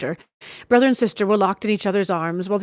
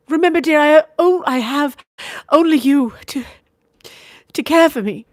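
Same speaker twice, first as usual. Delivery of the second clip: higher, louder lady's voice, teasing then pleading; tender lady; 5 semitones higher, 5 dB louder than usual. A narrator's reading. Sister fondly warning brother to be prudent. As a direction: coax him fondly to be careful.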